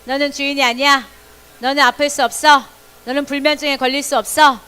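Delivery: loud, firm order to the body; loud command. Shown as a demonstration. Children declare to their own body, loud command. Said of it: "빨리 시작".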